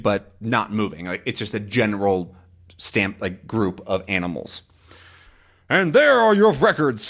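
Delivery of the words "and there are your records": loud, high comic-book outburst; mock yell. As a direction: shout it as a theatrical insult. Acts out Haddock yelling at the men.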